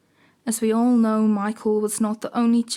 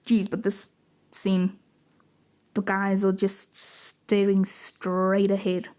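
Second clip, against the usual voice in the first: ordinary talk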